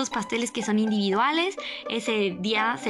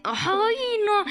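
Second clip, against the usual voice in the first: retold dismay; 10 semitones higher than usual. Her retold dismay at being blamed.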